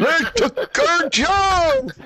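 high, slow, shouted through laughter; laughing shout. Retold or imitated. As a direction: yell it while cracking up. Repeating quote while laughing.

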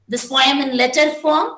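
ordinary talk

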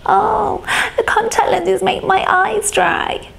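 high-pitched mock complaining; mocking whine. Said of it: "all he did was whine and complain".

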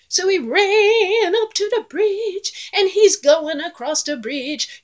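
high, slow, building storyteller excitement; excited narration. A narrator's reading. Narrator telling children the goat runs to the bridge, high and eager. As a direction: tell it like the big moment is coming.

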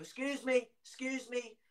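high, urgent mock calls; calling people over. Bloke summoning shoppers to help child, imitated urgent calling. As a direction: imitate a worried man hailing passers-by. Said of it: "He started shouting people over".

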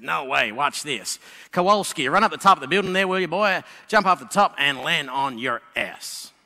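lightly played army general ordering a soldier; gruff American general. American general orders Kowalski to jump, brash bark.

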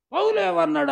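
pastor yelling the name out from the pulpit; loud shout. Retold or imitated. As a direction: bellow the question, high and loud. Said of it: "గట్టిగా అరిశాడు".

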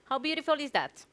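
normal talking voice

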